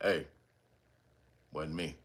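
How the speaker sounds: low deadpan denial, barely above a murmur; straight-faced denial. Retold or imitated. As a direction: mumble it low with a straight face. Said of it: "say it with a straight face".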